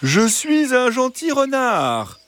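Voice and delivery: high, slow, sweet fox voice; soft reassuring fox. Acted. Fox at the henhouse gate, soft reassuring high voice. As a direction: coax them gently, far too sweetly. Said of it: "Une voix douce et rassurante".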